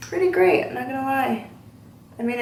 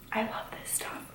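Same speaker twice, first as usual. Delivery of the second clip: whisper; 16 dB quieter than usual. Real, not acted. Whispered aside about favourite product.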